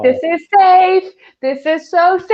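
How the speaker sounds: high, loud, drawn-out sing-song delight; elated cry. Retold or imitated. Telling friends how safe the suitor felt, elated sing-song. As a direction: crow it slowly, stretching the words. Acting out her elated reaction in story.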